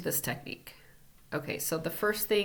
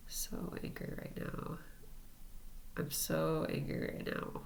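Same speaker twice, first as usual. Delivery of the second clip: hushed whisper of the anger phrase; whispered mantra; 9 dB quieter than usual. Shown as a demonstration. Tapping the chin, muttering anger to herself in a whisper. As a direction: whisper it so nobody nearby hears.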